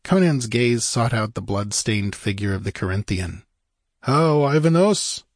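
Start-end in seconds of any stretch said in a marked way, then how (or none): 4.0-5.2 raised, slow challenge; firm, drawn-out question; challenging. A narrator's reading. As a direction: call him out by name. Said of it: "he challenged".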